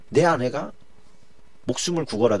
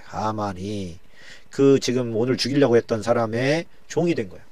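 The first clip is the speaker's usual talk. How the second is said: ordinary delivery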